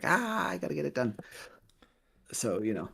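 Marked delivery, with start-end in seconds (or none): 0.0-1.2 high-pitched, strained self-imitation; mock panicked cry. Host imitating himself pressured by fans for updates, mock panicked. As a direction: act out your own flustered "ah!" in a high voice. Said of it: "And me going like".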